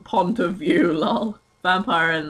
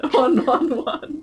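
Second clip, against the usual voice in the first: laughing; 8 semitones higher, 4 dB louder, slower than usual. Real, not acted. Reading "one" while laughing.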